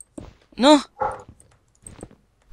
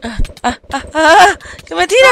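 panicked yelps going higher; screaming while falling; 7 semitones higher than usual. Real, not acted. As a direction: yell as you get knocked off. Being pushed off in game. (gritos de pánico al caer)

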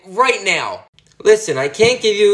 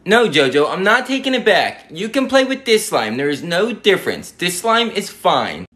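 normal talking voice

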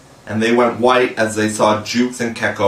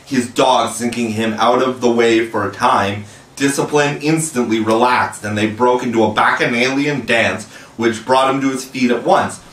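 ordinary delivery

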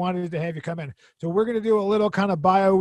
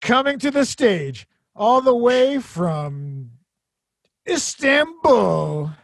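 drawn-out hammed-up introduction; stage announcer; 2 semitones lower, much slower than usual. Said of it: "we're gonna introduce you".

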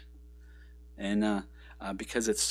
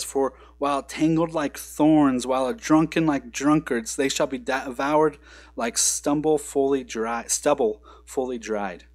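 loud, deliberate scripture proclamation; scripture reading; 3 semitones higher, 5 dB louder than usual. Acted. Pastor reading Nahum to church, loud proclamation. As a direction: declaim the verse to the congregation. Reads verse ten aloud.

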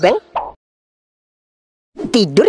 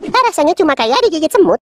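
usual speaking voice

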